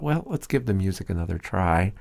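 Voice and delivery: normal talking voice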